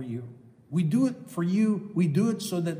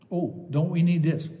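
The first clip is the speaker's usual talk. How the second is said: usual speaking voice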